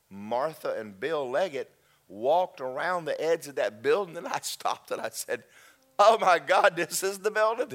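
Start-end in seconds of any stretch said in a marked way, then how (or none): 6.0-7.7 loud, high burst of recognition; high-pitched, thunderstruck yell; astonished exclamation. Retold or imitated. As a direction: shout it out in sudden amazement. Retold shock of recognising the building.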